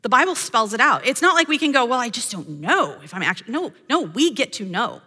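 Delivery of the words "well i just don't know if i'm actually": a lightly put-on hesitant voice, a little softer; mock unsure doubter. Believer who doubts she can know the truth, lightly mocked hesitation. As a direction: trail off as if doubting yourself.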